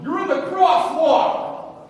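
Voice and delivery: high, drawn-out furious shout; angry yelling. Acted. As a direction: bellow it slowly like an outraged cyclist. Acted angry cyclist yelling at driver.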